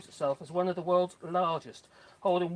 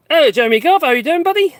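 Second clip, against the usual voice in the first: hearty call, much louder and higher; loud greeting; 9 semitones higher, 18 dB louder than usual. Real, not acted. Greeting a live viewer, calling out loudly. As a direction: shout a big hello across the garden.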